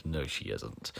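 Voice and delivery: usual speaking voice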